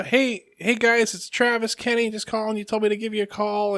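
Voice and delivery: awkwardly chirpy retold message; kid leaving phone message. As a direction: imitate your younger self on an answering machine. Retelling his childhood answering machine message.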